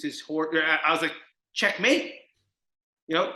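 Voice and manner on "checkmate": higher, rising little victory call; triumphant mock announcement. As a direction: sing out a rising checkmate. Acted quote of chess move.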